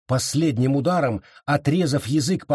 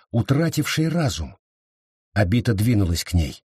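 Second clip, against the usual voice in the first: ordinary delivery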